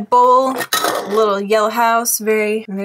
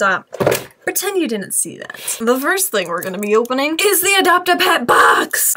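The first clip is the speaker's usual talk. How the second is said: ordinary talk, nothing marked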